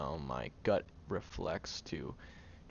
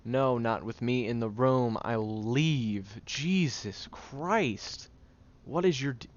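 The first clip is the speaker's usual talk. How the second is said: fed up; 4 semitones higher, 6 dB louder, slower than usual. Acted. Storming out of the room.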